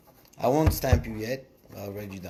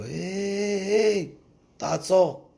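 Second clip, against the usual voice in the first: angels calling; 8 semitones higher, much slower than usual. Acted. Angels voiced in story.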